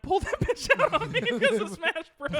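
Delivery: high, loud laughing. Real, not acted. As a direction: crack up mid-sentence. Laughing at own joke.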